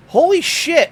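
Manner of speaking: loud, high "Holy shit" of alarm; alarmed shout. Retold or imitated. Retelling seeing dad bleed, alarmed shout. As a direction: yell it as you see the blood. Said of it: "And I'm like".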